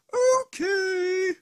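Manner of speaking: high, loud mock whimper; whiny okay. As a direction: whine it out like a sulking creature. Arceus sadly agrees.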